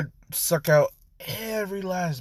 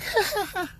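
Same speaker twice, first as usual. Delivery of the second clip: high squeaky giggle. Real, not acted. Narrator cracks up.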